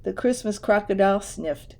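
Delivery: ordinary delivery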